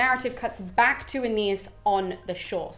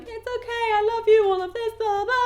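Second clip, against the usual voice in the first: much higher, mock-tender comforting; hushing a crying daughter; lightly hammed-up soothing father; Jupiter cooing; 11 semitones higher than usual. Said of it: "as he's cuddling Venus and being like".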